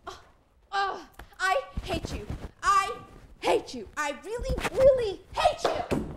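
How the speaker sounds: building from a cry to a high, loud shriek; rising furious yell; angry outburst. Acted. Wife shouting in marital fight.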